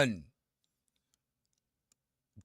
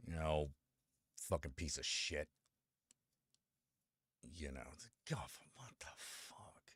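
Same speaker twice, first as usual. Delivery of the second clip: hushed unvoiced whisper; 11 semitones lower, 16 dB quieter than usual. Real, not acted. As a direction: breathe it out in a whisper, disgusted. Whispered rant about Gulf rename.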